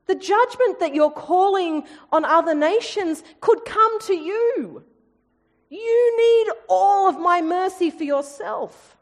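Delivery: high, forceful preaching as God; God urges Israel, high and intense; God pleading. Acted. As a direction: press it home as God. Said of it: "God says".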